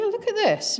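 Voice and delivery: a high, surprised call. Retold or imitated. Paul showing his wife the hidden box, high surprised call.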